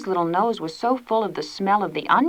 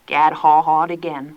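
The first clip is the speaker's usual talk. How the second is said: plain reading voice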